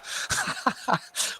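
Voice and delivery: high, soft burst of laughter; helpless giggling. Real, not acted. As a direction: crack up for real, high and breathless. Cracks up at chat joke.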